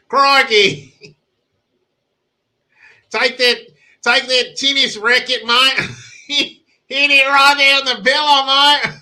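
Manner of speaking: hammed-up Australian accent; mock Australian accent. Mocking the video's Aussie blokes in an exaggerated Australian accent. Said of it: "does an Australian accent turn you on".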